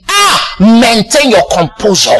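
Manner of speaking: ordinary talk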